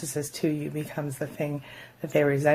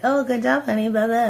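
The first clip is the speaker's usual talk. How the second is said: fake sweet praise; 7 semitones higher, 4 dB louder than usual. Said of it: "would have been like".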